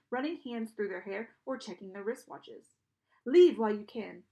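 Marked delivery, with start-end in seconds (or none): 3.2-4.2 loud, forceful dismissal; loud warning. A narrator's reading. Charon warns the children to go, loud warning. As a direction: order them out with force. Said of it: "Charon told us".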